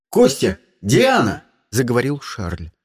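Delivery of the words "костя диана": loud summons by name; urgent calling. A narrator's reading. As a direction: call both of them sharply. Captain calls over intercom, urgent. (громко зовёт по именам)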